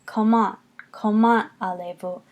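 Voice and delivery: French "Comment", stressed on its second syllable. Shown as a demonstration. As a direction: demonstrate "Comment" as ko-MAH, then the whole phrase. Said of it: "You're going to accent the second syllable".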